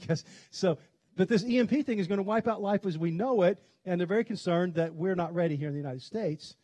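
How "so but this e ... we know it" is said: ordinary talk, nothing marked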